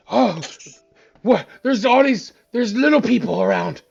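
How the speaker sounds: put-on loud startled drunk; startled drunken exclamation; town drunk.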